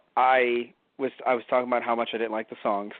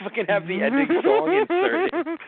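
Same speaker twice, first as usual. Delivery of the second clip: incredulous; 12 semitones higher, 4 dB louder than usual. Real, not acted. Incredulous high exclaim.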